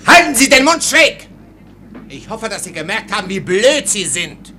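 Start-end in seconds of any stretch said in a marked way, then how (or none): 0.0-1.3 higher, louder furious yell; officer loses patience and shouts at his orderly; angry shout. Acted. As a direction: bark him into silence. Officer yells at rambling servant.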